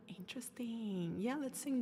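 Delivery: soft unvoiced aside; whisper. Real, not acted. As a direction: murmur it like a private thought. Reading chat, aside to self.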